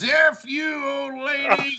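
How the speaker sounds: high mock yell at the old lady; imitating Clint screaming at the neighbor; retold scream. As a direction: throw it at her like a drunk shout. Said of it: "screaming at her".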